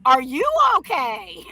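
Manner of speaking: son mocking it back. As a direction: throw the question back with sneering laughter. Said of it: "My son starts laughing at him".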